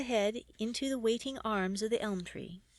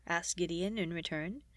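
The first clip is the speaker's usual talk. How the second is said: ordinary delivery, nothing marked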